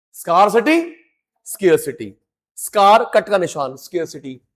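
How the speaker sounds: ordinary talk, nothing marked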